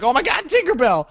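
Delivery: excited high-pitched squeal of recognition; excited fan. Retold or imitated. Excited fan spotting her favorite character at the show, thrilled. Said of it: "she's like".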